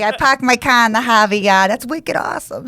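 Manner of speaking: laughing Boston-area Massachusetts accent. Shown as a demonstration. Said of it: "I did work on my, uh, Massachusetts, uh, accents".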